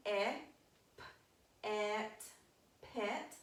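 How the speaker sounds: "e, p, et" split into sounds then blended into "pet"; teacher segmenting a word for the class; sounding out. Shown as a demonstration. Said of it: "It has a short sound".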